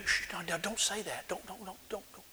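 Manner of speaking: urgent, very soft shushing; hushing someone. Acted. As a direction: hush them as if others might overhear. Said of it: "the first thing we generally do when we hear people talk like this".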